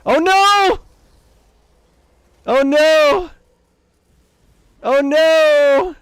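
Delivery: high, loud panicked cry; panicked yelling. Real, not acted. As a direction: shriek it in real panic. Ship engine damaged, panicking.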